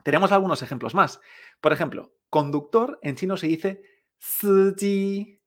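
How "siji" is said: SIJI con dos sílabas altas y planas; tono uno uno. Shown as a demonstration. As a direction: alarga SIJI en una misma nota. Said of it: "Fíjate, uno uno". («siji» alto y sostenido, como robot)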